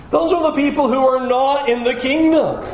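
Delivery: ordinary delivery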